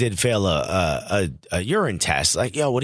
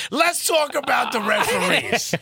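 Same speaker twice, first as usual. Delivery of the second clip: imitating the coach; 11 semitones higher than usual. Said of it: "He was like".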